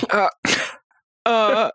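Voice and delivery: lightly voiced one-syllable impression; brief character grunt, held apart; one-syllable Ron. Shown as a demonstration. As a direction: try a character voice on a single grunt. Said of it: "a one syllable Ron impression".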